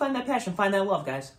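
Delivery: pumped-up fake guru urging the audience; mock motivational. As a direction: preach it like a cheesy life coach. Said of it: "repeats the pep talk".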